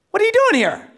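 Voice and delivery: very high, loud startled question; shocked wife. Retold or imitated. Wife finding her husband in the house, shocked. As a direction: blurt it out high in mock disbelief.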